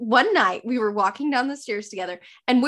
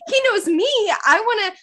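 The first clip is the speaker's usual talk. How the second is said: normal talking voice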